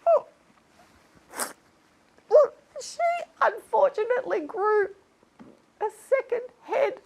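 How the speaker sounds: high-pitched, weepy mock sorrow; tearful telling. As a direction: struggle through the sad news. Tearful telling of fan's misfortune.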